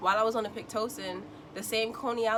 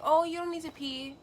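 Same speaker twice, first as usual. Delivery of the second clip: lightly voiced dismissive nurse; brushing her off, a touch higher; a hint of the nurse; 9 semitones higher, 5 dB louder than usual. Nurse telling the patient she only thinks she needs to pee, lightly mocked dismissive tone.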